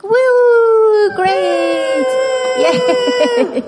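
high, loud happy whoop; excited cheer. Pretend. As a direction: cheer it out for the kids. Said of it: "Raise your hand if you think".

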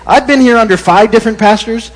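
put-on high, loud old church lady; a loud, high elderly saint delivering the zinger; old lady punchline. Elderly lady's punchline in joke.

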